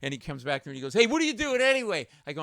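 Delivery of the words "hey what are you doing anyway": high, loud challenge; lightly voiced drunk demanding an answer; drunk heckler. Drunk bleeding man questions him.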